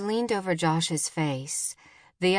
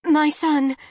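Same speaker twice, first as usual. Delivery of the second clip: loud, high panicked blurt; frantic mother on an emergency call; urgent rush; 9 semitones higher, 7 dB louder than usual. A narrator's reading. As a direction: blurt it out in alarm. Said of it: "Jen spoke in a rush".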